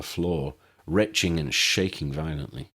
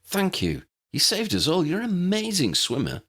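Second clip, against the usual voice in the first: Harry, relieved; 11 semitones higher than usual. Acted. Harry praises Lydia after the swim, higher relieved voice. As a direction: voice young Harry, breathless praise. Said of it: "Harry said as he joined them".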